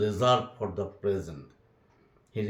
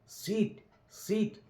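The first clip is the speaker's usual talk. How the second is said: excited call; 11 semitones higher, 3 dB quieter than usual. A narrator's reading. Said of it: "Tom became excited".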